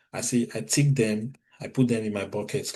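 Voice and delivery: ordinary talk, nothing marked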